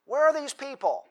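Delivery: baffled cry of where are they; bewildered Elijah. Retold or imitated. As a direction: Elijah looking around for the others. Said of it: "He's looking around".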